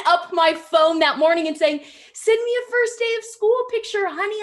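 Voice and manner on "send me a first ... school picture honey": imitating mom. Mom voiced in story.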